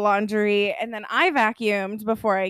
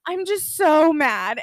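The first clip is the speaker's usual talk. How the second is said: put-on higher, louder, drawn-out drunk griping; mock drunken anger; 5 semitones higher, 8 dB louder, slower than usual. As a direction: imitate a drunk friend stewing, loud and slow. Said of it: "super drunk".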